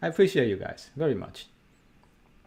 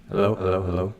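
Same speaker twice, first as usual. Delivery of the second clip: echoey room sound; cave echo; 3 semitones lower, faster than usual. Real, not acted. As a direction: voice bouncing off walls. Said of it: "I do have a crazy echo right now".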